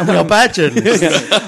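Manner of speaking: slow, amused, laughing through it. Real, not acted. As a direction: chuckle the joke out slowly. Joking self-mockery among co-hosts.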